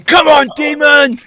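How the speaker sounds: high, loud, drawn-out taunting yell; hammed-up shout goading a demon; mock aggressive challenge. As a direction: imitate a hot-headed ghost hunter yelling. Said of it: "then you got Rich".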